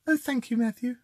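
high, soft mock-royal lady; the Queen. Host trying Karen thanking Matthew, high soft Queen voice. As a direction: thank him like a softly spoken Queen. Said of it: "No, that's still the Queen".

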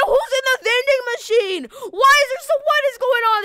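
high, loud disbelief; baffled outcry. Pretend. Player spots someone in the vending machine, loudly baffled. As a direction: yell it out in bewildered alarm. Baffled yell amid game chaos.

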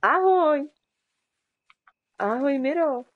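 loud, high called greeting; calling out hello. Real, not acted. Streamer calling hello to a passing player, loud. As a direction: call out across the distance.

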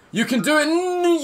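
high-pitched, straining encouragement; excited squeal of encouragement; urgent cheering. Real, not acted. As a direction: urge the dog on, voice shooting up. Urging the game dog on.

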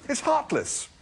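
very high-pitched outcry; distressed objection. A narrator's reading. Arthur condemning the dinner, distressed. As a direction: cry it out high, aghast.

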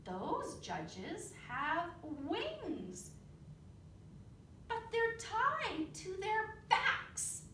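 lively read-aloud with big character voices; storybook reading. Acted. Teacher reading a bug story to the children, animated. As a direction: read it like a picture book for the class.

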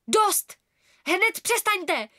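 loud, high, angry command; boy shouting at fighting friends; furious shout. A narrator's reading. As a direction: stop them all with a yell. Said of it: "rozčílil se Oskar".

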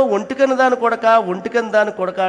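loud, high schoolyard jeer; jeering kids teasing the one-eyed boy; mocking taunt. Everyone taunting the son.

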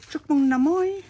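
loud, bright Vietnamese New Year call; cheerful greeting. Real, not acted. Greeting viewers loudly for Chinese New Year. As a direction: call it out to everyone, high and loud.

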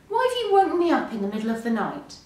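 fast, high, indignant complaint; angry. A narrator's reading. Pig woken by friends at night, angry. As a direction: rattle it off crossly. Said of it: "asked Pig angrily".

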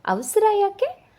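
lightly voiced coaxing mother-in-law; questioning lift. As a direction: jump way up on the question.

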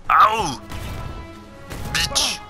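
normal talking voice

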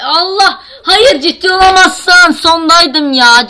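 loud, high, slow cry of frustration; outraged wail. Real, not acted. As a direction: howl at the game in disbelief. Sonda ölüyor, isyan. (yavaş, yüksek sesli isyan)

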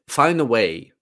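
usual speaking voice

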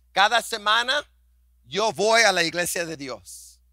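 ordinary talk

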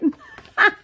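high, loud burst of laughter; laughing. Real, not acted. Vlogger laughing at her own clumsy mishap, loud burst of laughter.

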